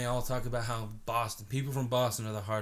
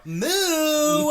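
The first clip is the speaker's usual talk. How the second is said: very high, loud moo; high shrieked moo; San Francisco cow call; 9 dB louder than usual. Retold or imitated. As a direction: squeal the moo. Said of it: "San Francisco cow".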